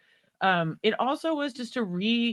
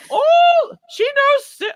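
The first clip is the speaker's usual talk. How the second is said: put-on a failed Mancunian accent pitched way up and loud, drifting toward Apu; bad Mancunian accent; 11 dB louder, much slower than usual. Imitating an excited caller's voicemail for a guest, in a high, loud, bad Mancunian accent. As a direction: squeal it out in a botched Mancunian accent. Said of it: "I cannot do a Mancunian accent".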